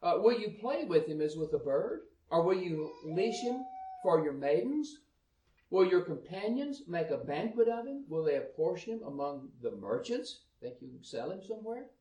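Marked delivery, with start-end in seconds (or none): 0.2-10.5 raised, higher Bible reading voice; scripture reading. Acted. Preacher reading Job to the congregation, raised and declaiming. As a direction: read the verses out with force.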